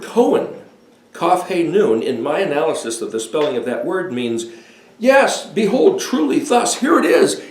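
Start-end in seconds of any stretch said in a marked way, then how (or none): 5.0-7.4 excited proclamation. Pretend. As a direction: announce it like revealed truth. Proclaiming the meaning of Cohen.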